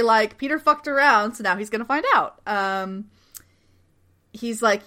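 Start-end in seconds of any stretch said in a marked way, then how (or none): none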